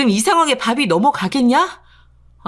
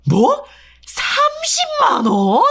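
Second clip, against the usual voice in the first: much higher, drawn-out slow 삼십만 원; incredulous; much slower than usual. Acted. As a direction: stretch it out in mocking disbelief. Husband incredulous at amount. (어이없다는 듯 길게 늘이는 말투)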